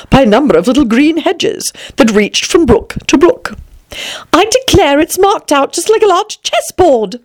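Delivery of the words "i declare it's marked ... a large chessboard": high-pitched, delighted Alice. A narrator's reading. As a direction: exclaim in high, girlish wonder. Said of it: "she added in a tone of delight".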